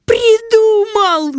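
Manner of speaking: high, drawn-out joyful yell; excited shout. Acted. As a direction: cry out with a sudden idea. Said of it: "закричал Вася".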